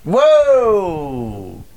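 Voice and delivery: loud amazed exclamation; surprised whoa. Real, not acted. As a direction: blurt it out, genuinely stunned. Huge discount revealed.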